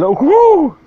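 be the whole room going whoo at the heckle; comic imitating a room reacting to his putdown with a whoop; crowd whoop. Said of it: "I could feel the room just going".

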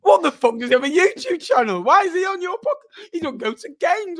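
mock disbelief, loud and high; hammed-up outrage at a podcast guest who skips games; outraged friend. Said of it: "he took a gasp, he goes".

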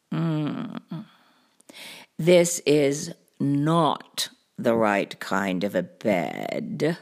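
low, slow cartoon cat voice; deep, measured storybook cat; pondering cat. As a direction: weigh it slowly, deep and deliberate. Cat ponders the stained bed.

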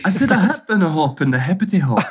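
playful rap lyric in a put-on English accent. Shown as a demonstration. Guest showing off for laughing hosts, English accent. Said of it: "Can you do an English accent?".

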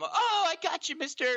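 high, excited prank reveal; gleeful gotcha. Retold or imitated. Prankster kid to neighborhood dad, gleeful gotcha.

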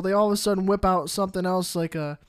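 ordinary talk, nothing marked

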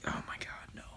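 hushed, whispered dread; barely voiced private dismay. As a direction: whisper it under your breath. Retold dread at stranger approaching.

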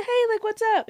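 high bright hello; friendly greeting. Retold or imitated. Teacher answering the boy, high and cheerful. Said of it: "I said".

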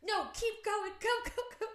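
very high, loud frantic cheering-on; urgent mock yell. Wife urges partner after the truck, urgent mock yell. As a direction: urge them on in comic desperation.

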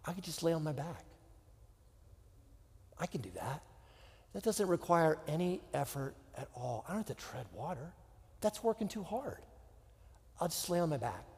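soft, hushed sigh of realization; relieved calm. Acted. Tired swimmer realizing he can float, quietly relieved. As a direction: let all the effort drain away.

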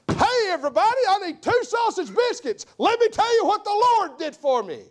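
high, loud hammed-up proclamation to a diner crowd; mock loud announcement. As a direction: call it out to the whole restaurant in jest. Mock loud testimony at Hardee's.

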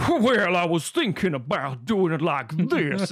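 a hint of an over-the-top Southern accent; Daniel Craig's Southern accent. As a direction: ham up a lightly Southern detective voice. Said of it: "let's bring Daniel in".

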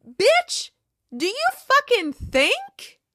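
indignant, high and loud; angry outburst. Real, not acted. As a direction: let the anger burst out, pitch soaring. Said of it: "contain my anger for this woman".

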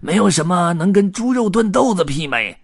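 high, loud, relishing character voice; greedy hobo dreaming of a meal; mouth-watering tramp. A narrator's reading. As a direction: savour the thought of dinner. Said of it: "黑胡子咽了咽口水说".

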